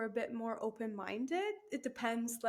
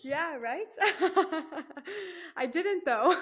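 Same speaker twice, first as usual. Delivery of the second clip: laughing through the words; giggly, amused reply; 7 semitones higher, 6 dB louder, slower than usual. Real, not acted. Answering the golf joke with a laugh.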